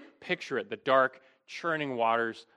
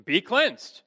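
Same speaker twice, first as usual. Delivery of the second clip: firm, higher-pitched retold command; 6 semitones higher, 4 dB louder than usual. Jesus to the leper, commanding. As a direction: quote it as an order. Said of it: "he commanded".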